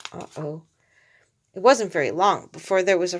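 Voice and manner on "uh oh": a soft, much quieter Uh-oh; quiet aside. A narrator's reading. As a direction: murmur Uh-oh to yourself.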